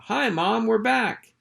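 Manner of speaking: a small pup calling out home; little pup voice. Acted. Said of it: "a little voice called out".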